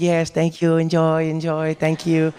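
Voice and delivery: lightly voiced polite host; a touch of warm hospitable thanks; mild accommodating homeowner voice; friendly host. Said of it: "he's like".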